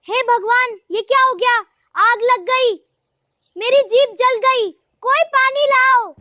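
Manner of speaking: hammed-up distress, pitched high; burning-mouth panic. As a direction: wail for help as if the tongue is on fire. Chilli burns mother's mouth, calls for water.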